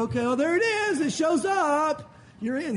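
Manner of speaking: playful cry of discovery; mock excited discovery. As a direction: act out spotting it with hammed-up delight. Acts out seal check at judgment.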